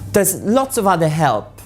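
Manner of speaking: normal talking voice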